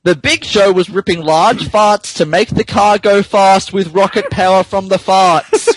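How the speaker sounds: normal talking voice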